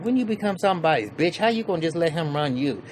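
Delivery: lightly voiced scoffing friend; mock taunt. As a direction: needle him about being bossed. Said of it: "He's like".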